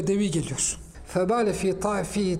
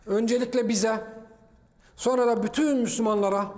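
usual speaking voice

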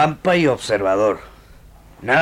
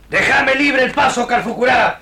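higher, forceful order to clear the way; shouted demand, a hint of bluster; commanding shout; 6 semitones higher than usual. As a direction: bark it at the chief. Villain demands passage.

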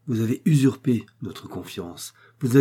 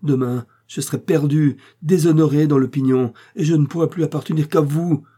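loud, higher-pitched tirade; brusque vehemence; 4 semitones higher, 6 dB louder than usual. A narrator's reading. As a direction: accuse him with mounting heat. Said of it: "avec une brusque véhémence".

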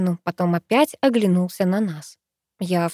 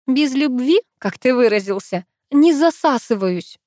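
higher-pitched, mock-solemn declaration; serious; 5 semitones higher, 3 dB louder than usual. A narrator's reading. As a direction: state it firmly, in earnest. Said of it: "серьёзно проговорила я".

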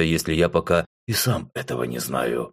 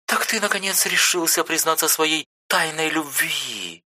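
поддразнивая; 9 semitones higher than usual. A narrator's reading. Сестра дразнит брата.

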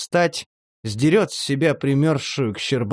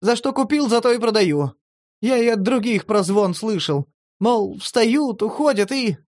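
much higher, offhand; Vena's street talk; 8 semitones higher than usual. A narrator's reading. Vena passes on the rumour, much higher. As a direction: pass on gossip as is.

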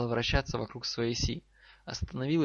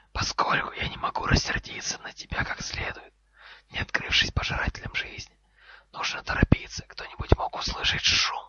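stern, urgent whisper; commanding snake hiss. Acted. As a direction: hiss it as an order, voiceless. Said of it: "скомандовала змея".

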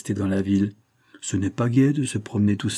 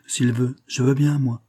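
plain reading voice

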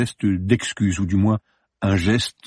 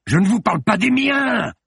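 higher, snapping rebuke; sharp rebuke; 13 semitones higher, 3 dB louder than usual. Acted. Morbus rebuking Judith, sharp and high. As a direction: put her in her place sharply. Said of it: "la raboua-t-il vertement".

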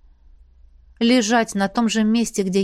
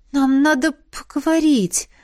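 higher-pitched, yawning words; through a yawn; 6 semitones higher than usual. A narrator's reading. Drowsy Celesta being laid in bed, talking through a yawn. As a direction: say it while yawning. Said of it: "Селеста громко и широко зевнула".

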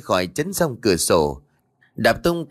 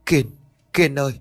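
raised, higher urgent call to her son; anxious calling out; 6 semitones higher than usual. A narrator's reading. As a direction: call out nervously, waving him back. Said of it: "hồi hộp rồi vẫy tay gọi".

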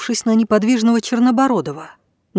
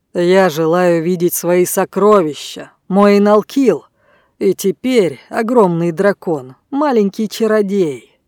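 commanding sorcerer voice. Acted. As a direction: bellow the true name like a spell. Blackbeard commanding the wizard. (властный голос чародея)

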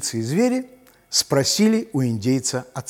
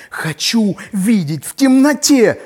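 lynx voice; 8 semitones higher, 6 dB louder than usual. Acted. Lynx asks to see.

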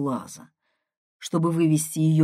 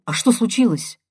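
quick, higher-pitched query; quick question; 6 semitones higher than usual. A narrator's reading. Brother asking his sister what happened, quickly. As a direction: cut in promptly with the question. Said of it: "быстро спросил я".

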